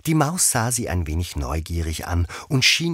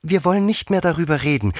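high, loud, fast assurance; eager Alice, pitched high and quick; eager, anxious to please; 11 semitones higher, 5 dB louder, faster than usual. A narrator's reading. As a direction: hurry to change the subject. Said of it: "sagte Alice eifrig bemüht".

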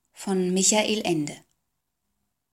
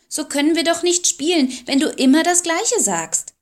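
put-on exasperated girl; 9 semitones higher, 4 dB louder than usual. A narrator's reading. Girl frustrated with doll.

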